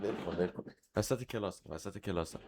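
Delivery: low, very soft aside; grumbling to oneself. Real, not acted. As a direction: grumble it under your breath. Grumbling to self about the interruption.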